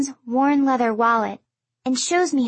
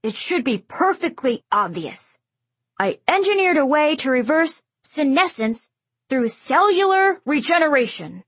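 louder, exasperated lecturing; 4 semitones higher, 3 dB louder than usual. A narrator's reading. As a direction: explain with weary impatience. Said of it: "He gives an exasperated sigh".

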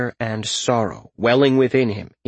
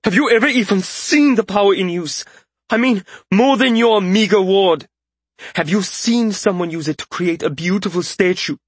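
high, impassioned outburst; indignant mage's raised-voice tirade; shouting; 11 semitones higher, 4 dB louder than usual. A narrator's reading. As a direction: challenge them with rapid-fire questions, voice raised high. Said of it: "the mage whipped around and shouted".

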